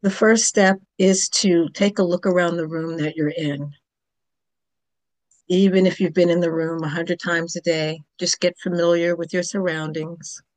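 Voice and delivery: calm, unhurried Yoga Nidra guidance with long pauses; yoga teacher leading a guided relaxation, calm and measured; guided meditation. Acted. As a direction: gently guide the listener toward sleep. Leads a Yoga Nidra session.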